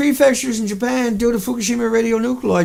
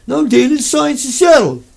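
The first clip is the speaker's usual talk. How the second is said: higher, louder mocking tone of a smug official; mock expert; 5 semitones higher, 5 dB louder than usual. Mocking officials lecturing Dana, higher and louder sneer. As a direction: parrot the know-it-all sneeringly.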